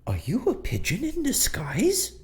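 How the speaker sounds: high, startled woman's voice; startled shopkeeper woman. Acted. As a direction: gasp it out as a spooked shop girl. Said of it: "this girl's kinda thrown off guard as well, like".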